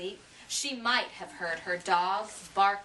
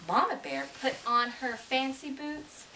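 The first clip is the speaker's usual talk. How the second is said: plain reading voice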